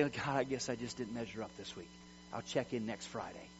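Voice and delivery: low, soft mumbled apology; defeated, meek prayer. Acted. Preacher acting a believer who fell short, low and defeated.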